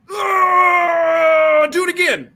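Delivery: drawn-out high howl into the words; mock pained wail. Kicked man begging for another, high mock agonized cry. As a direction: wail it like a man badly hurt, hammed-up.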